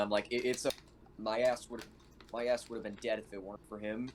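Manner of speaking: normal talking voice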